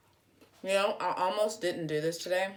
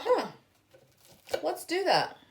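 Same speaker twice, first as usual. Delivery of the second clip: lightly voiced inner thought; 11 semitones higher, 4 dB louder than usual. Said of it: "I thought".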